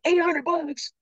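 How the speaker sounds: very high-pitched, disbelieving outburst; astonished kid. Retold or imitated. Son hearing what the first day pays, high-pitched amazement. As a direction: squeal the amount in amazement.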